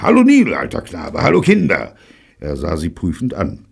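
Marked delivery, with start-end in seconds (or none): 0.0-2.0 loud greeting with high leaps; called-out greeting. A narrator's reading. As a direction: hail them all loudly. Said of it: "rief er". (lauthals gerufene Begrüßung)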